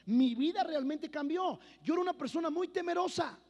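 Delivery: emphatic testimony. Acted. Testimony climax.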